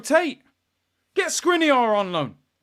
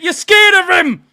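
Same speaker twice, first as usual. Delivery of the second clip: angry taunt; 9 semitones higher, 9 dB louder than usual. Real, not acted. First taunt.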